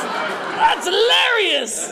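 high, drawn-out squeal; mock hilarity. Speaker imagining hearing his own taped talk, squealing mock hilarity. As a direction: stretch it out high in mock amusement.

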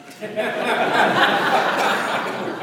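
airy high-pitched giggle; high breathless laugh. Real, not acted. Laughing at his own reluctance to take a friend along, breathless. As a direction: let out a real breathless laugh.

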